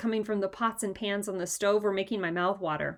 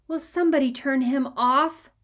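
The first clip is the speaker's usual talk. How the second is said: exasperated plea; 5 semitones higher, 5 dB louder than usual. A narrator's reading. Exasperated big brother.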